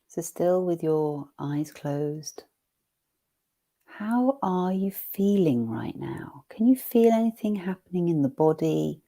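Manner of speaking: hushed guide voice with eyes closed; guided meditation. Acted. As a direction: gently guide attention into the body. Guided body check.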